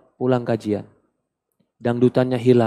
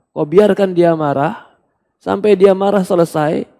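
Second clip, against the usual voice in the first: normal talking voice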